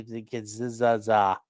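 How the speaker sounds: Kazaza drawn out slowly, syllable by syllable; sounding out the name. Real, not acted. Host puzzling over a viewer's name, slowly sounds it out. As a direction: spell out Kazaza slowly as if testing it. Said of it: "Is that what you call her?".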